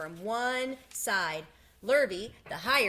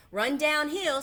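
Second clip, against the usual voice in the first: higher, louder cow voice; cow suggesting; 7 semitones higher, 7 dB louder, slower than usual. A narrator's reading. Cow advising Wilbur, eager raised voice. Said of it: "suggested the cow".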